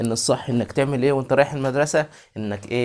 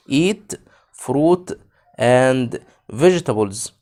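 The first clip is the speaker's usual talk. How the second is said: and vegetables drawn out slowly; slow model; much slower than usual. Shown as a demonstration. Teacher rereading a sentence with kids, slowly. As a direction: read it slowly again with the class. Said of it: "يلا نقرأ تاني".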